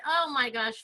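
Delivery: ordinary delivery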